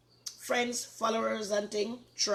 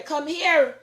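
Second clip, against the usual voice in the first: higher, louder repeat of the call; 9 semitones higher, 11 dB louder than usual. Real, not acted. Calling a grandchild again.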